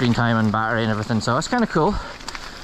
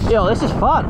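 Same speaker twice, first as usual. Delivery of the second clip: loud high thrilled shout; excited; 11 semitones higher, 5 dB louder than usual. Real, not acted. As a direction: yell it with pure joy. Thrilled riding downhill.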